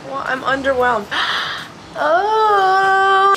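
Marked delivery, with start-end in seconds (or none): 2.0-3.3 long, high, loud "oh"; delighted squeal. Real, not acted. Birthday girl seeing her surprise, long delighted squeal.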